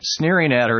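ordinary delivery

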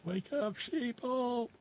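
very high, hushed squeal; soft falsetto mock holler; small mock cry. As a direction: echo the rallying cry in a tiny high voice. Repeats the cry after laughing.

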